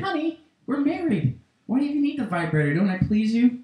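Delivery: very soft, hurt pleading; pleading husband. Acted. Husband asking his wife if he pleases her, soft pleading. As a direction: drop to a hushed, tender appeal. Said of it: "And then I say".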